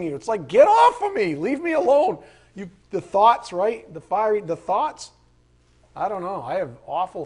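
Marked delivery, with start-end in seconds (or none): 0.5-2.2 high, loud plea to be left alone; acted desperate protest. Preacher acting out fighting off sinful thoughts, high loud protest. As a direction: shout it as if fighting off a pursuer.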